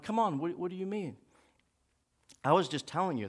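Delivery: quiet, hurt reply. Retold or imitated. Hurt woman answers quietly.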